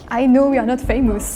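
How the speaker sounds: ordinary delivery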